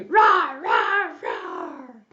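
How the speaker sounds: repeated play roars; ferocious lion roar. A narrator's reading. Storyteller acts out the trapped lion, ferocious roaring. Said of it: "was roaring ferociously".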